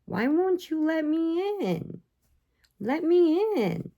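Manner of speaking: abandoned kitten begs at the empty door, high and plaintive; pleading kitten. Pretend.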